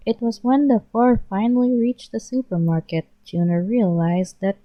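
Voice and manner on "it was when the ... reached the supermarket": ordinary delivery, nothing marked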